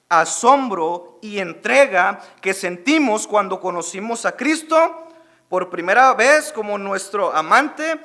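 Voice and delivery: loud, fired-up sermon delivery; preaching. Acted. Pastor preaching loudly to his congregation. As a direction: preach it out to the whole church, full force.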